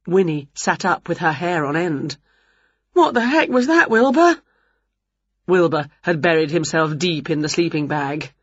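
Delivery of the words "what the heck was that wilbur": high, alarmed question; startled question. A narrator's reading. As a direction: ask it spooked by a noise. Startled by noise in woods.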